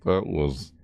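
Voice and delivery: usual speaking voice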